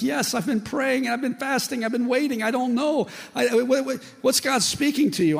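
high-pitched, overwhelmed outpouring; imitated bawling. Man at prayer meeting confessing his waiting, mock-tearful. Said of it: "And then he starts bawling".

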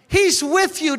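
preacher's ringing refrain; ringing proclamation. Acted. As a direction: hit it harder the second time. Repeated louder, higher.

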